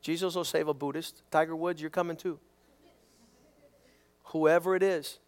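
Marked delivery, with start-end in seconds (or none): none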